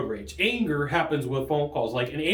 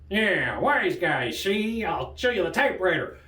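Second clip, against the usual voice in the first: old-movie gangster impression; thirties gangster. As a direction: play a comic 1930s wise guy. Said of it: "talking like a thirties gangster".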